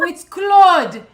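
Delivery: loud, high, hammed-up French accent; exaggerated French accent. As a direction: insist on it as an exasperated Frenchman. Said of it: "he'd say it more French".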